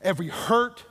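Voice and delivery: loud, high, driving list; preaching crescendo. Acted. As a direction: pound each line home. Building sermon climax.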